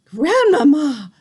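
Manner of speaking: long, loud, high call; shouted. A narrator's reading. Boy shouts down to grandmother, long and loud. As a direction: call her name down the tree. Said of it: "I shouted".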